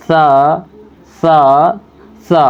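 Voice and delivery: "سا" with the tongue tip between the teeth; letter demo. Shown as a demonstration. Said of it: "اس طرح سے بولیں گے".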